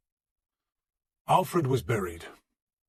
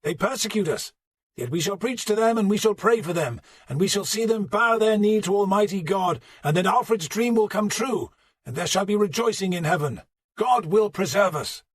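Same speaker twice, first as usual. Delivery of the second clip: preaching; 9 semitones higher, 5 dB louder than usual. Acted. Archbishop preaching at funeral.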